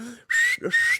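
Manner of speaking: very high, hissing alarm bursts; imitated alarm noise. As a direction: sputter like bells and whistles going off. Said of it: "every bell and whistle and alarm in me".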